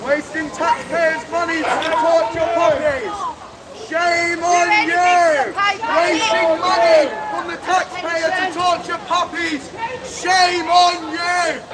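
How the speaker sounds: drawn-out shouted chant; slow, stretched shout of shame; protest shout. Real, not acted. Protester shouting at the lab workers, slow drawn-out shouting.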